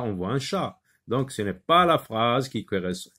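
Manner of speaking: usual speaking voice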